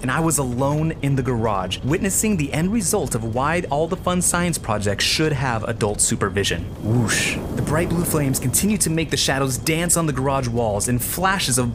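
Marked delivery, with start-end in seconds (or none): none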